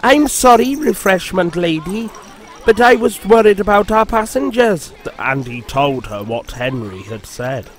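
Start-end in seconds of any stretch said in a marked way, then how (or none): 0.0-4.9 apologetic. Acted. Engine apologising to lady.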